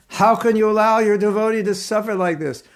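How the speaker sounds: high, reproachful cry to Krishna; angry complaint. Retold or imitated. Devotee reproaching Krishna for a friend's suffering, mock-angry. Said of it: "She's becoming angry with Krishna".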